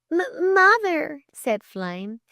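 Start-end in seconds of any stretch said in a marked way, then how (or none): 0.1-1.2 high, slow stuttered correction; hesitant stammer. A narrator's reading. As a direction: stumble on the first sound in a high girl's voice. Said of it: "Flame echoes his stammer".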